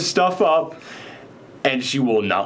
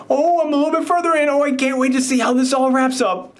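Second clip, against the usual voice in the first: much higher, quick, breathless delight; gushing fan; 11 semitones higher than usual. Retold or imitated. Annie telling the author how far she has read, high and gushing. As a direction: squeal like a fan who can't wait for the ending. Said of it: "every single day she comes back and is like".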